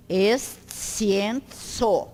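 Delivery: "escienzo" very slowly as es-tsi-en-tso; syllables pronounced separately. Shown as a demonstration. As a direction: break "escienzo" into parts, each C as TS. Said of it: "cada sílaba é pronunciada separadamente".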